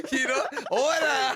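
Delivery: high, laughing greeting; high giggly greeting through laughter; laughing hola. Real, not acted. Teasing greeting while laughing.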